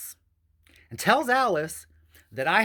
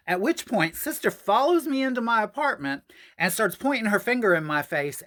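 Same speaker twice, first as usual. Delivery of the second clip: ordinary talk, nothing marked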